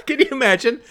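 high, loud burst of laughter; words bursting out through real laughter; laughing hard. Real, not acted. Host imagines the absurd scenario, laughing hard.

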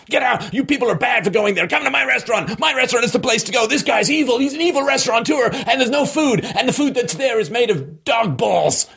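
high, hammed-up shouting rant; mock screaming owner. Restaurant owner screaming at customers to leave, mock yell. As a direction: yell like an unhinged shopkeeper on the sidewalk. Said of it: "he's just screaming".

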